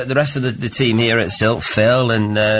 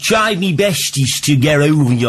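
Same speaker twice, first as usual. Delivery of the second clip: put-on Stoke accent. Radio host mimics the song's singer, Stoke accent. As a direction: do the lyric in a broad Stoke accent. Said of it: "there's a real Stoke accent".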